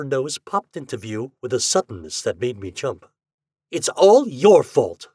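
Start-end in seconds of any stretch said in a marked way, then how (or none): 3.7-5.1 high, loud, slow accusation; angry blaming. Acted. Ghost glares at the husband, angrily accusing. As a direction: throw the blame at him, loud and drawn out. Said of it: "she glared at me".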